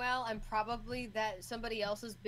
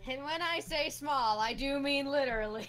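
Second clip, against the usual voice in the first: loud emphatic; 6 semitones higher, 11 dB louder than usual. Acted. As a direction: insist loudly it's literal.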